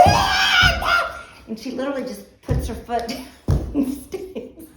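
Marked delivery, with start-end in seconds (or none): 0.1-1.2 very high shrieked ah ah; mock scream. Teller imitating friend shrieking in the water, mock scream. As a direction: shriek in panic. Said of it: "And she's like".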